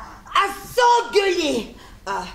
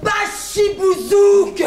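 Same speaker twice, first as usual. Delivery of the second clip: hammy stage actor; much slower than usual. Acted. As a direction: declaim the insult like a classical tragedian. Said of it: "si madame se met à déclamer les classiques".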